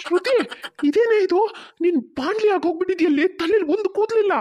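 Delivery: high, loud shocked exclamation; shocked outcry. Acted. Husband shocked at wife's bald head, loud high outcry. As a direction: call out in disbelief at her bald head. Seeing Shruti suddenly bald.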